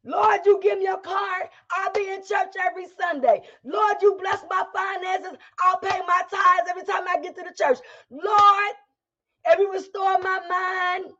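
high, pleading bargain; bargaining with God. Retold or imitated. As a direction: cry out a desperate deal. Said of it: "you make these declarations".

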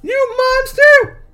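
high, loud shrieking heroine; shrieking lady. Acted. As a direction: scream it like a terrified damsel. Young lady screams at monster.

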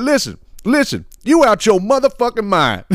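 high, insistent mock scolding; mimicked telling-off. Comedian retells the passenger confronting the woman, high mock telling-off. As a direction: imitate an exasperated passenger setting someone straight. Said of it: "He's like".

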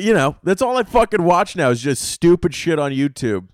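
usual speaking voice

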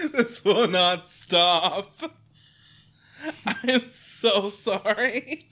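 slow, high, helpless laughter; can't stop laughing; apologizing through giggles; laughing fit. Real, not acted. Laughing fit during recording.

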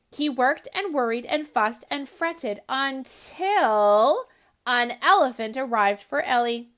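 lively storybook read-aloud voice; story narration. A narrator's reading. Host reading a picture book to children, animated.

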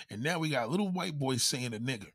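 usual speaking voice

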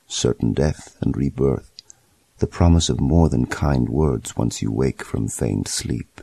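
usual speaking voice

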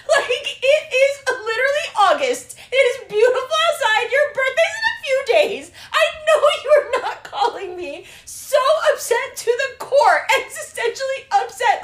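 high, loud laughing rant. Real, not acted. Laughing rant about Virgo.

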